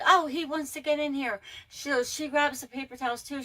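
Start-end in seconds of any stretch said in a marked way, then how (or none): 0.1-1.4 imitating a coworker's high, louder coaxing to a child; mock-cheerful kid-coaxing voice; higher and louder, sweet talk to a little boy; coaxing talk to a toddler. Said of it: "Andy was like".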